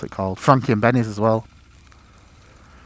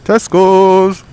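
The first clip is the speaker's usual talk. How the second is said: loud, high shout of a name; calling out; 7 semitones higher, 9 dB louder than usual. Real, not acted. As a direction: call it out as you pass.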